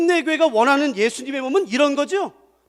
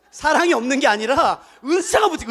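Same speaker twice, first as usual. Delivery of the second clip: usual speaking voice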